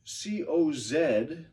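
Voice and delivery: letters said slowly one by one; teacher spelling out slowly. Shown as a demonstration. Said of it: "It's spelled".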